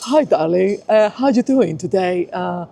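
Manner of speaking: halting, half-hearted English accent impression; attempted English accent. Shown as a demonstration. Showing the interviewer an English impression, hesitant English accent. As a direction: have a tentative go at an English accent. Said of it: "hear your English impression".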